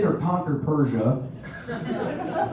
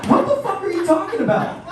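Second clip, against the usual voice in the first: ordinary delivery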